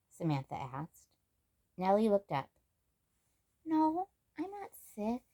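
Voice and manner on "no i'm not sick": put-on higher, slow little-girl voice; a small girl brushing off worry; young girl. Nellie reassures Samantha, higher soft little-girl voice.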